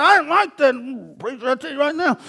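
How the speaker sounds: imitating an offended man's grumble; mock huffy. Offended leper grumbling about the preacher, mock huffy. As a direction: play huffy and puffy, half under the voice. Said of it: "got blew up and huffy and puffy".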